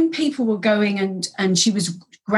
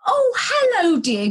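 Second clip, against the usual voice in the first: imitating old lady; 11 semitones higher, slower than usual. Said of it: "she went".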